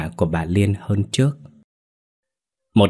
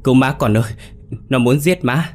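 higher-pitched acted cry for help; panicked plea; 5 semitones higher than usual. Frightened mother begging her son for help after a nightmare, panicked. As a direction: plead in fright, pitch climbing.

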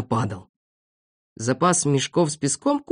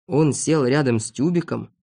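usual speaking voice

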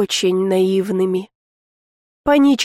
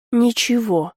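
usual speaking voice

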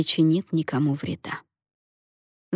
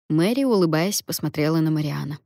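ordinary delivery, nothing marked

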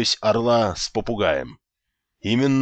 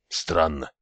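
usual speaking voice

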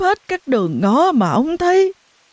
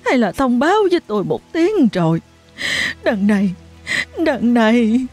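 distraught mother; 7 semitones higher than usual. A narrator's reading. Mother pleading with neighbour about missing daughter, distraught. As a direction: beg for help, voice breaking.

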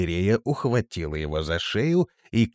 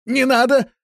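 high, loud panicked plea; terrified yell; 8 dB louder than usual. A narrator's reading. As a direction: scream to stop it. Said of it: "заорал испуганный Крепыш".

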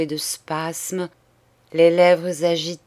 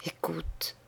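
much softer, half-breathed écoute; hushed; 13 dB quieter than usual. Acted. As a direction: drop the voice to a murmur. Dying sister urges her sister. (écoute murmuré tout bas)

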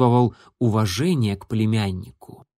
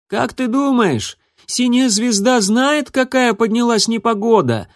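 shouting over wind; 10 semitones higher than usual. A narrator's reading. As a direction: call out over howling wind. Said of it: "пытаясь перекричать вой ветра".

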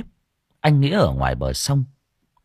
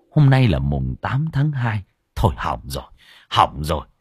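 alarmed monk; 3 semitones lower than usual. Acted. Monk alarmed, urging the woman to lead him home. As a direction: mutter in dread, then order them to hurry.

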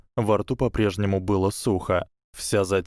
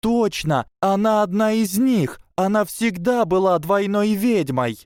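much higher, louder, breathless excitement; excited squeal; 12 semitones higher, 4 dB louder than usual. A narrator's reading. Tracey insisting to the girls, high and thrilled.

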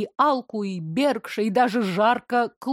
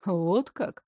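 lower, slow, smooth man's voice; low velvet male voice; 3 semitones lower, 4 dB quieter than usual. Acted. Mayor arrives behind the heroine, low velvet male voice. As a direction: announce yourself calmly and low.

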